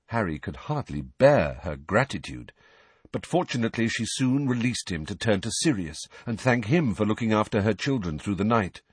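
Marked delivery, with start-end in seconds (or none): none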